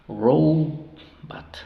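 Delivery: "Robot" slowly split as ROW-bat; open first syllable. Shown as a demonstration. Teacher models syllables for students, "Robot" drawn out as ROW-bat. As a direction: stretch the long O of "Robot", then short "bat". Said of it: "suena O".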